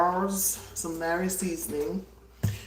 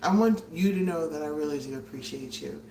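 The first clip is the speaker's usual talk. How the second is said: normal talking voice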